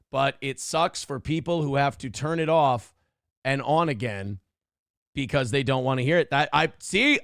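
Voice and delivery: normal talking voice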